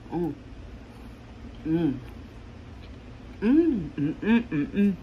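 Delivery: humming with mouth closed; savoring a hot bite; mouth full chewing. Real, not acted. Seen 2: Takes forkful at -1.0, chews with closed mouth throughout humming.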